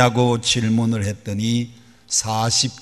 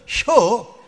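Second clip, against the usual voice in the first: a single high clipped 혀 in Chungcheong dialect, with a laugh; 11 semitones higher than usual. Retold or imitated. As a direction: fire off one high syllable in Chungcheong dialect. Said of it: "얼마나 짧고 빠른지 모릅니다". (짧고 높은 충청도 사투리)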